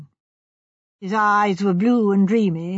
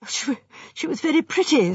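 higher, faltering start; lightly voiced young man confessing; hesitant young architect; 6 semitones higher than usual. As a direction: begin haltingly, embarrassed. Architect haltingly explains himself.

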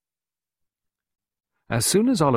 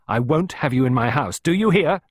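louder, higher, indignant warning; angry order; 7 semitones higher, 6 dB louder than usual. A narrator's reading. Clarissa advancing on Costello in her house, angry. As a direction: lay down the law to an intruder.

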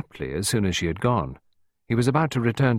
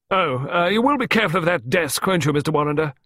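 high, loud, commanding warning; peremptory; 8 semitones higher, 5 dB louder than usual. A narrator's reading. Visitor warning man about the desk, peremptory. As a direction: insist on it, brooking no argument. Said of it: "she said peremptorily".

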